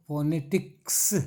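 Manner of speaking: फोनेटिक्स slowed, with a long final S; syllable demonstration. Shown as a demonstration. Teacher showing how फोनेटिक्स is said, slow with drawn-out ending. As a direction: split फोनेटिक्स and hold the closing S. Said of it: "ऐसे".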